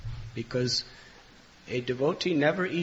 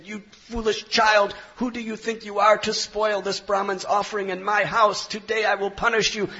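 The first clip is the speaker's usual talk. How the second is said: put-on raised, higher voice, telling off a child; angry scolding; 8 semitones higher, 5 dB louder than usual. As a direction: scold him loudly, threatening punishment. Father chases and scolds child.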